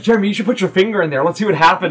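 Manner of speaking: put-on quick, high-pitched child voice; mischievous kid. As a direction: egg a playmate on, fast and gleeful. Kid eggs on another.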